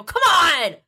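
frustrated yell at the television. Retold or imitated. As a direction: shout it like your team is losing. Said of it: "where I go".

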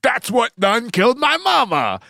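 mock outraged shout. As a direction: howl it like a hammed-up tragedy. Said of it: "And he's like".

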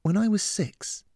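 put-on high-pitched young girl's voice; earnest girl, recollecting. A narrator's reading. Lillian begins telling Atticop a childhood memory, earnest.

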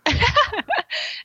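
a burst of high laughter; bright amused laugh. Real, not acted. Laughing at the scene.

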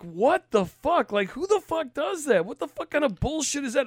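mock outrage, voice jumping up high; retold outrage. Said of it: "But I'm like".

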